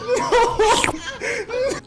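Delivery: a sudden high burst of real laughter. Real, not acted. Laughing at the roommates getting in trouble, real high laugh. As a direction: break into a high squeal of a laugh.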